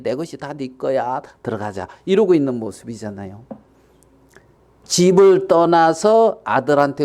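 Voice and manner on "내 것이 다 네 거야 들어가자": soft, lowered reassurance; quiet fatherly invitation; gentle coaxing father. Acted. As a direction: coax your son to come in. Father coaxes son inside.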